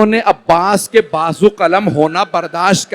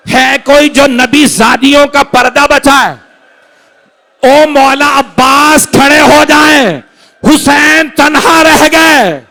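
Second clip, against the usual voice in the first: climbing higher and louder into a slow wail; rising lament cry; 8 semitones higher, 7 dB louder than usual. Acted. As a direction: build it to an anguished call for help. Preacher's cry for help rises. (धीमी, बुलंद होती फ़रियाद)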